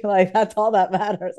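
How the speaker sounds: ordinary talk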